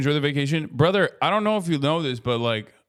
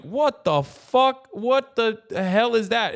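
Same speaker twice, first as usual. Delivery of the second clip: startled passerby; 8 semitones higher, slower than usual. Retold or imitated. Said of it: "when someone just goes like".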